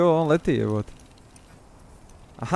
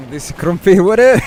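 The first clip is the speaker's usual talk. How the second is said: excited greeting; 5 semitones higher, 10 dB louder than usual. Acted. Greeting a game character loudly.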